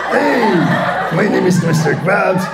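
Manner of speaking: gruff crab boss voice, lightly done; Mister Krabs voice. Shown as a demonstration. Voice actor doing Mister Krabs, lightly voiced. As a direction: bark it like a greedy crab. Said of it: "Lahat ng karakter sa SpongeBob".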